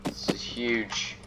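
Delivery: very slow, soft drawn-out huge; slow, awed. Real, not acted. Gamer awed by a huge room, slow and soft. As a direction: let it sink in slowly.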